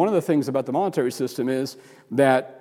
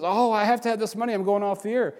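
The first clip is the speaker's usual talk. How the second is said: mock TV preacher pleading; 6 semitones higher than usual. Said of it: "the guy on T V says".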